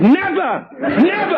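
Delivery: very high shouted never; mock yell. Comedian retells Steve shouting at wives, high mock yell. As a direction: yell it like a golfer refusing. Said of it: "Steve yelled".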